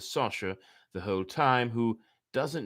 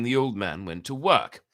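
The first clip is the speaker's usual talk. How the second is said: ordinary delivery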